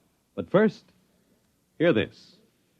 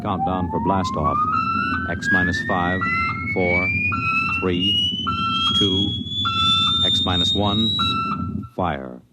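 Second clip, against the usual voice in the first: countdown. Acted. Rocket launch countdown.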